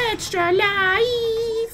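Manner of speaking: squeaky, slow, very high "Extra life!"; chipmunk voice. Retold or imitated. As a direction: stretch it out like a sped-up cartoon chipmunk. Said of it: "like, [name] voice".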